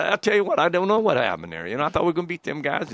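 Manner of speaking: mock Southern football coach accent; Southern drawl imitation. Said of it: "Got a little Southern to him".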